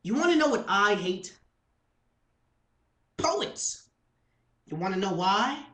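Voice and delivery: forceful slam-poetry opening; spoken-word performance. Acted. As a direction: throw each question out at the audience. Poem begins in earnest.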